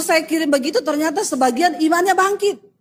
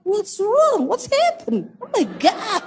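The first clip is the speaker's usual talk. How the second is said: high, swooping shocked voice; mock alarm; 7 semitones higher, slower than usual. Hotel porter finds her crying, mock alarmed. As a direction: imitate a startled onlooker, pitched high.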